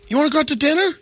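high, sing-song mooch voice; mock sweet invite. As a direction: mimic a relative angling for a free meal. Mocking the mooching relative.

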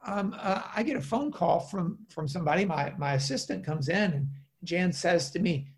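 ordinary talk, nothing marked

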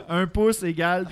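laughing. Real, not acted. Mocking the map scale.

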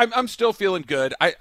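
normal talking voice

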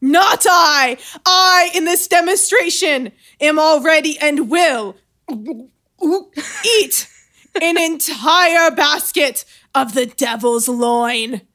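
loud, high, slow declaration; grand proclamation. Acted. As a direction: declare it boldly to the crowd. Colonel declaring to the crowd.

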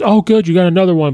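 lightly voiced Doctor Strange, quick and upbeat; imitating Strange. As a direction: toss it off like a pleased wizard. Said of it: "he goes".

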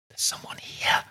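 frightened aunt's unvoiced-breath whisper, hushed. A narrator's reading. Aunt to nephew in the dark house, whispered. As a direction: whisper it to someone right beside you. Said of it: "she whispered".

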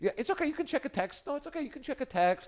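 high-pitched mock-polite voice; put-on high reassurance; mock permission. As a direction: lift it high, pretending to be accommodating. Mimicking a date granting permission.

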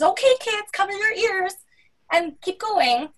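high singsong mock-cheery parent voice; airy, high-pitched "nothing to see here"; breezy dismissive parent. Mimics parents who brush it off.

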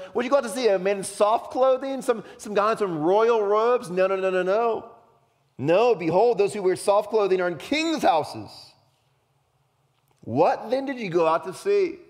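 high, loud, heated rhetorical questions; frustrated. Acted. Jesus defending imprisoned John to the crowd, frustrated. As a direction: hammer the crowd with indignant questions and denials. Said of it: "he's frustrated that his".

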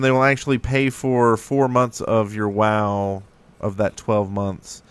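ordinary talk

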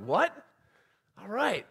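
a high, startled "What?" eased into "All right"; shocked surprise. Retold or imitated. Dad stunned his family likes his idea, high surprised reaction. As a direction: act floored that they liked it. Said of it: "I'm like".

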